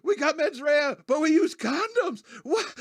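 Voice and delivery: very high, drawn-out mock wail; hammed-up panicked squeal; flipping out. Wilder's character flips out over what he lip-read, mock hysterical. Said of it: "He starts flipping out".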